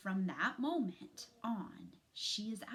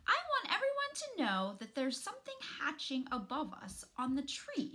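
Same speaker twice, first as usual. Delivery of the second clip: excited little voice; 7 semitones higher, 3 dB louder, faster than usual. Acted. Littlest possum excited announcement.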